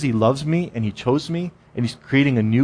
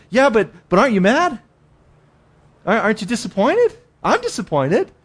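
higher, pleading voice of a guilty believer; whiny protest; 8 semitones higher than usual. Retold or imitated. Believer protests to God that he must be mad, higher and whining. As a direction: whine it like you can't believe you're forgiven.

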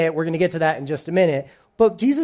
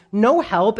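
ordinary talk, nothing marked

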